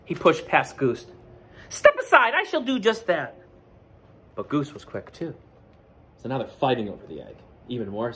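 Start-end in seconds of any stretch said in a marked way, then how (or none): 1.7-3.4 high and loud, pushy; bossy Duck. Acted. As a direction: shove past like a bossy duck. Duck pushes past Goose.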